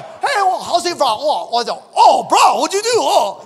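high-pitched, excitable bro voice; hammed-up, jumpy dude chatter; mock bro banter. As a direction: play startled buddies reacting to a blunder. Acting out bros after wrong button.

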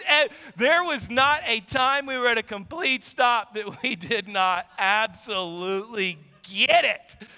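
normal talking voice

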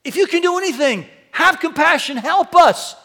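high, loud cry for help; desperate plea. Acted. Father's desperate plea, repeated.

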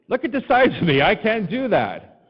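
high, loud protest, slowing at the end; mock outrage. Pastor loudly protesting the five-gallon tank. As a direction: complain big about a tiny tank. Complaining about tiny tank.

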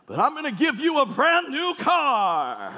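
high, drawn-out mock announcer cry; game-show prize announcement. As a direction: belt it out like a big prize reveal. Cop announces prize in imagined scene.

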